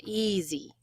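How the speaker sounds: "Easy" with a long first syllable, softly falling off; stress on first syllable. Shown as a demonstration. Teacher echoing the model, "Easy" with first-syllable stress. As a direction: draw out the start of "Easy", let the end fade. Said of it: "it kind of falls off there at the end".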